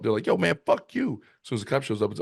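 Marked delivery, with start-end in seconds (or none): 0.2-1.2 high, hostile street addict voice; crackhead voice. Retold or imitated. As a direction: sneer it like a twitchy addict. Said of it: "how good I did my crackhead voice".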